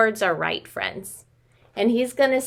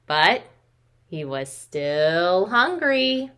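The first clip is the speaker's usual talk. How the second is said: lively storybook reading with slowed refrains; reading to children. Acted. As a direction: read it to little ones, drawing out each day. Reads picture book to children.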